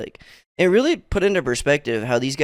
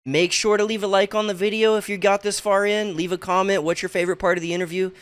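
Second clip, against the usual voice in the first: outro read; 6 semitones higher than usual. Acted. Video outro call to action.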